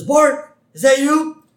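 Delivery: loud, high-pitched shout; acted cartoon dad yelling; Homer. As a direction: holler to someone in another room. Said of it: "And Homer says".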